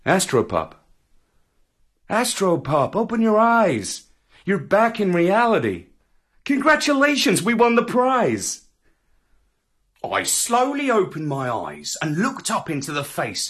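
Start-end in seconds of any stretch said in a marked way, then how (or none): none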